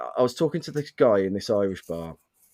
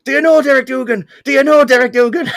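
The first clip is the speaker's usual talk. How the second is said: mock drunk yelling, very high and loud; aggressive drunken shouting; 11 dB louder than usual. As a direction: bellow it like an insistent drunk at the bar. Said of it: "he was so aggressively".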